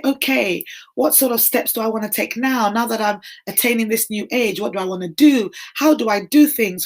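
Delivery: ordinary delivery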